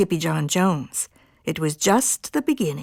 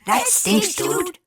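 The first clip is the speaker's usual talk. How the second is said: high-pitched little dog voices; doggies exclaiming; 11 semitones higher, slower than usual. A narrator's reading. Doggies sympathize with their amigo, high exclaiming. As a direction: blurt it out high.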